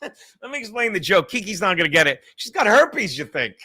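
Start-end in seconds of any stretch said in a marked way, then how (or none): none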